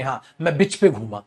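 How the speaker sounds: usual speaking voice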